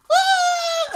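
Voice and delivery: very high mock squeal; high-pitched imitation crowd cheer; crowd reaction. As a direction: squeal like a whole audience reacting. Imitating everybody in audience reacting.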